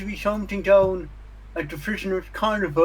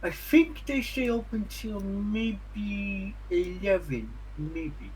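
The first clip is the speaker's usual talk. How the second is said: unsure townsperson; 7 dB quieter, slower than usual. Acted. Townsperson guessing closing time for visitors, slow toothy voice. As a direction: drag it out as an unsure local, then drop away.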